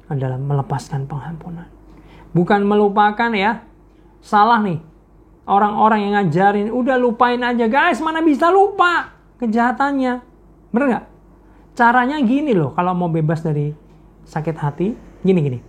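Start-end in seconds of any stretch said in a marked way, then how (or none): 2.3-12.7 preacher rousing the crowd about forgiveness; preaching at full force. Acted. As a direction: drive it home to the congregation. Preacher rebuking a teaching.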